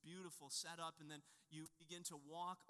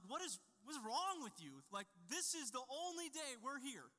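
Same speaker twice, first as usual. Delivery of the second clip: higher and louder, fed-up scolding; exasperated dad; 7 semitones higher, 5 dB louder than usual. Retold or imitated. Dad scolding grumpy kids in the heat, exasperated. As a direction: snap at bored kids who won't look. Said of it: "And so I'm like".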